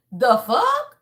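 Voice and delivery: a mock-incredulous God, rising at the end. Tarot reader voicing God scoffing at the viewer, mock incredulous. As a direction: scoff at them in disbelief. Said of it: "And God is saying".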